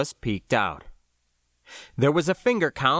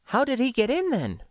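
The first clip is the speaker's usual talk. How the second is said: higher-pitched puzzled question; wondering aloud; 11 semitones higher than usual. A narrator's reading. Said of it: "Floy wondered aloud".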